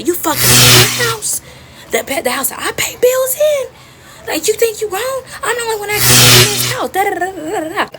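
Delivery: high-pitched mother's tirade, loud at peaks; acted screeching house-rules rant; furious mama. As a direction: yell like an outraged mama. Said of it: "now she's even more mad".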